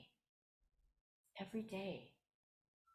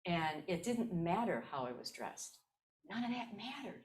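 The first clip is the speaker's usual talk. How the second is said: ordinary talk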